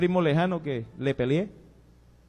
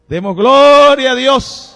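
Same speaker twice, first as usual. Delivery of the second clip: a much higher, much louder, slow cry of praise; joyful praise shout; 9 semitones higher, 18 dB louder, much slower than usual. Real, not acted. Preacher calls the congregation to glorify God, loud and joyful. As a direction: lift it up to God with a laugh.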